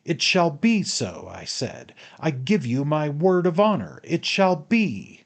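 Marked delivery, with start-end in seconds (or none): 0.0-1.3 high, loud, emphatic vow; heated and overbearing; angry insistence. A narrator's reading. Furious master insists against refusal. 2.2-5.2 high, loud, emphatic vow; heated, raised-voice decree; angry insistence. A narrator's reading. Furious master insists against refusal.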